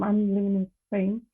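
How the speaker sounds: ordinary talk, nothing marked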